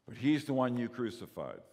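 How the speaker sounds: ordinary delivery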